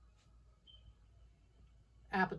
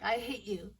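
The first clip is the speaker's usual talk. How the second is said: put-on sulky kid; 2 semitones higher than usual. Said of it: "like a kid is guilty".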